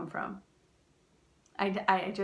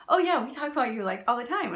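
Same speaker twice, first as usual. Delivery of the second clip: higher and louder, eagerly insisting; excited stranger; 3 semitones higher, 6 dB louder, faster than usual. Retold or imitated. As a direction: keep gushing that they talk about her. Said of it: "He goes".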